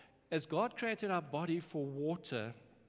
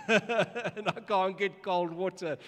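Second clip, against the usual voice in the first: laughing; 4 semitones higher, 6 dB louder, slower than usual. Real, not acted. Daughter took all the cold water.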